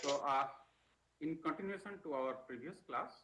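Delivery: faint and far from the mic; too soft to hear well; barely audible. Real, not acted. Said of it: "Your voice is very low".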